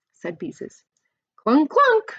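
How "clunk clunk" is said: much higher, louder gleeful clunk; happy shout. A narrator's reading. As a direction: shout it out with delight. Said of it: "shouted Ramona happily".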